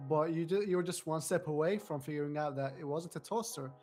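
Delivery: normal talking voice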